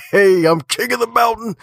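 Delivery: high-pitched gleeful crow; mock boast. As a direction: crow it like a cocky champion, way up high. Acting out boasting after wins.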